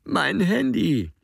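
slow, high tearful plea; Alex crying, hand stretched out; whimpering again. A narrator's reading. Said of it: "wimmert Alex".

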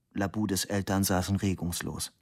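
plain reading voice

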